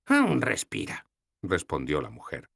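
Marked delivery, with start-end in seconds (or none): 0.1-1.1 put-on higher, slow old woman; voiced old woman, unhurried; old goddess's voice. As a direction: answer calmly and slowly. Logna voiced.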